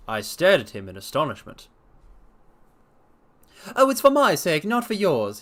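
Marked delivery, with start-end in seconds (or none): 3.8-5.4 much higher, louder exclamation; cried out. A narrator's reading. Blessington insisting to the doctor, high loud cry. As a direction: burst out eagerly. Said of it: "he cried".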